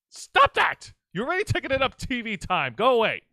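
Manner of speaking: high, loud mock scolding; mock shout. Co-host yells at an imagined pest taking TV time. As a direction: yell at the pest to scram.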